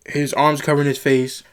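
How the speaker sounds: ordinary talk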